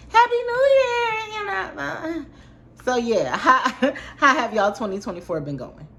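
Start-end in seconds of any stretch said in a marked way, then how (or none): 0.1-2.2 high, loud sing-song Happy New Year; hammed-up festive greeting; mock cheerful greeting. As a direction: chirp it like a bubbly hostess. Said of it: "coming to this video saying".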